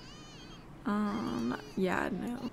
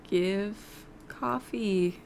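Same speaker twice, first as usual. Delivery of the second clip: normal talking voice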